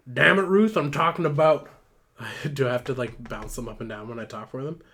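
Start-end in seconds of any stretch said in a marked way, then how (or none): 0.1-1.7 loud, raised-pitch exasperation; acted angry scolding. Benny confronts Ruth, acted angry scolding. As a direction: lay into a slacking coworker.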